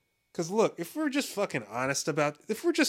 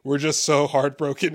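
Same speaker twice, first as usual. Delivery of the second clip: louder, solemn fake grief; hammed-up sorrowful statement; lightly voiced mournful friend; mock heartbroken; 8 dB louder than usual. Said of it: "and he's like".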